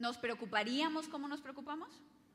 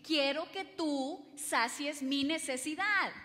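slow, louder clingy demand; slow, clingy mock plea; needy demand; 2 semitones higher, 6 dB louder, slower than usual. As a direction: plead like a needy wife. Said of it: "nos volvemos así de que".

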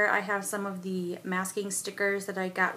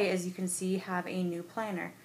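ordinary talk, nothing marked

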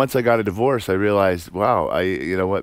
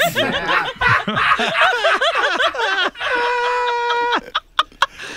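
a big burst of high laughter; howling with laughter. Real, not acted. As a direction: crack up at your own punchline. Laughs at own poem line.

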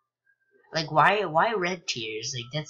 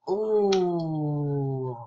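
ordinary talk

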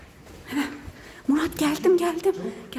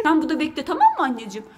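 ordinary delivery

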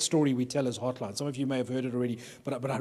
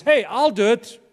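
loud, high, keen; eager volunteer; 7 semitones higher, 8 dB louder, slower than usual. Retold or imitated. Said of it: "Moshe says".

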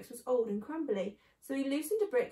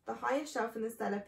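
ordinary delivery, nothing marked

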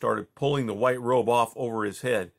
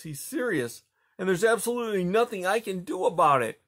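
ordinary delivery, nothing marked

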